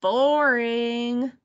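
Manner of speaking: drawn-out, unimpressed "boring"; bored grumble. A narrator's reading. Martin dismisses making lemonade, grumbling in boredom. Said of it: "muttered Martin".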